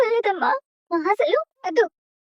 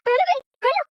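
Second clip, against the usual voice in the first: higher, fierce command to leave; angry order out; 6 semitones higher, faster than usual. Acted. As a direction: drive her out of the hospital. Mother orders daughter away.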